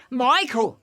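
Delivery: distant mum calling; mum calling upstairs. Retold or imitated. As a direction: shout up the stairs. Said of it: "my mum from downstairs going".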